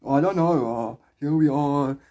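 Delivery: put-on Afrikaans South African accent; slow down into a heavy Afrikaans accent. Shown as a demonstration. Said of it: "That's Afrikaans South African".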